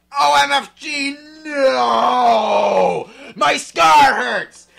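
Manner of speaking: high, loud anguished scream, slowing. Acted. Vampire screaming as his scar hurts, high and loud. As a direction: cry out in pain. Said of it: "he started to scream".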